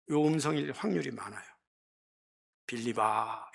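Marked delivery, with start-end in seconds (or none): 2.7-3.5 lower, solemn reverent 빌립아; holy solemn call. Shown as a demonstration. Mock holy Jesus calling Philip, solemn. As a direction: intone 빌립아 slow and holy. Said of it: "이렇게 거룩하게 안 부르셨어요".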